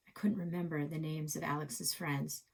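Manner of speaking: plain reading voice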